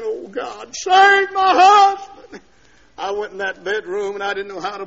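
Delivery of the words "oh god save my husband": high, loud, drawn-out plea; wife crying out to God for her husband; pleading prayer. Retold or imitated. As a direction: cry out to God for him. Wife praying aloud, retold.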